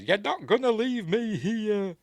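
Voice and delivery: very high, loud hammed-up fury; enraged mock shout. Pearl stopping the projectionist from leaving, acted enraged shouting. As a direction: scream it like a melodrama madwoman. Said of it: "she starts shouting at him".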